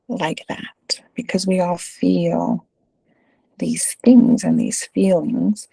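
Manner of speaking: slow hushed whisper to reassure. Real, not acted. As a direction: lean in and whisper it gently. Stressing shared feelings to listeners.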